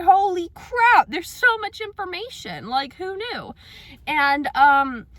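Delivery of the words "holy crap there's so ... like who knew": shocked surprise. Retold or imitated. Reading the giant side-effects sheet, surprised and high. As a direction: gasp it out, stunned by the pile of paper. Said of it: "and I'm like".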